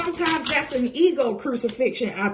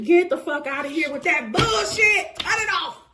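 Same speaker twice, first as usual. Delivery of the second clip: shouted; 6 semitones higher than usual. Acted. Snapping at fake readers.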